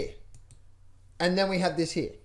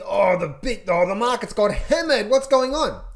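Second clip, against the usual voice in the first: louder, rising mock alarm; mock panic; 3 semitones higher, 6 dB louder than usual. Mocking traders as the market gets hammered, louder alarm.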